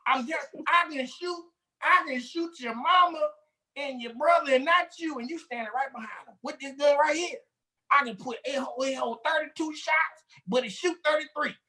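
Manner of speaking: high, hammed-up boastful gun nut; gun bragger. Mocking gun nuts to a friend, hammed-up boasting. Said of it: "That's what they say".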